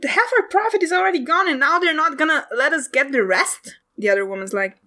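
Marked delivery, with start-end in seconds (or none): 0.1-3.8 high, snapping retort; hot-headed hunter snapping at her older partner; angry hunter. Acted. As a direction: bite back at the one calming her. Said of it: "She's like".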